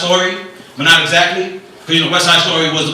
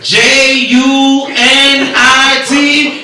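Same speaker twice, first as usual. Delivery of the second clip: slow spelling, one letter at a time; 5 semitones higher, much slower than usual. Retold or imitated. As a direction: spell out each letter slowly. Kid spelling slowly.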